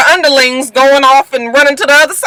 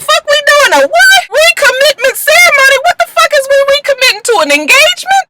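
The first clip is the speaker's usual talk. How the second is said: much higher, shouted disbelief; shocked outburst. Retold or imitated. Fiancée hearing about a recommitment ceremony, high shocked shout. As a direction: shriek it like you can't believe it. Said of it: "And I was like".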